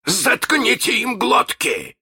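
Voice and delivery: high, drawn-out captain's bark; barked order. Acted. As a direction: bark the command at the crew. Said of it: "рявкнул Крюк".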